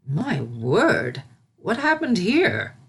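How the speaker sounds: slow, startled fussy exclamation; fussy servant taken aback; shocked old servant. A narrator's reading.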